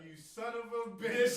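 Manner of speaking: slow, high, loud mock outrage; retold yell. Stranger walking up to Dylan in game, drawn-out yell. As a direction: stretch it out loud, imitating the stranger. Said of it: "yelling something".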